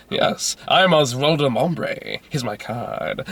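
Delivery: put-on slow, drawn-out hammy English accent; absurd English accent. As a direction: seductive posh Englishman introducing himself. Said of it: "talking with this absurd English accent".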